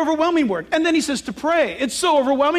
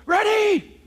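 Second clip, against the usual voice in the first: sergeant's shout; 8 semitones higher than usual. Retold or imitated. Sergeant at paratrooper plane door.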